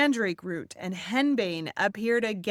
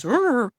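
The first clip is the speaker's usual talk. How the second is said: a loud ghoulish growl, higher and louder; 4 semitones higher, 8 dB louder than usual. Shown as a demonstration. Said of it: "this thing with my voice".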